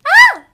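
loud, high scream; full scream. Shown as a demonstration. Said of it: "How do I do my scream?".

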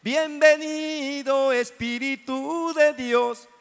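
high, slow reverent welcome; welcoming the Spirit. Acted. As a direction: stretch it out in reverent worship. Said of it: "le estamos hablando a Él".

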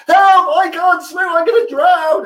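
very high loud cries for help; the big man yelling in real fright; panic. Acted. Giant panics in the water.

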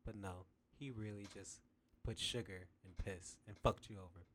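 ordinary delivery

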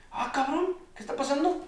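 startled panic. Retold or imitated. Retelling panic as the quake starts.

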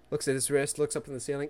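normal talking voice

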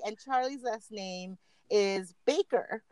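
usual speaking voice

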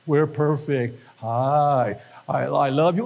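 low, monotone Stepford churchgoer; Stepford robot. Acted. Preacher mocking perfect Stepford church members, low robotic monotone. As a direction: drone it evenly, like a programmed perfect person.

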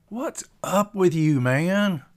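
high, loud, drawn-out question; concerned challenge. Acted. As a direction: press a sulking friend. Tater challenges sulking Pilot.

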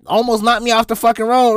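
usual speaking voice

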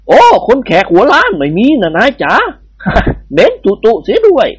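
lightly voiced Indian bandit; Indian babu voice. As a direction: play the amused bandit babu. Babu character voice.

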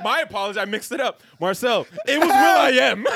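high, loud fake-polite correction; hammed-up polite announcer; mock formal apology. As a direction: apologize with exaggerated courtesy. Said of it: "He was like".

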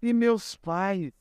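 mild imitated crying. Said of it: "tudo isso já chorando".

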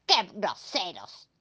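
quietly offended monster; complaining monster. Acted. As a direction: grumble it slowly in a put-on monster voice. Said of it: "se quejó".

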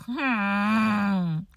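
low, soft doubtful hum; skeptical hmm. Retold or imitated. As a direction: hum your suspicion at the cashier. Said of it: "looking at her like".

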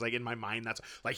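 ordinary talk

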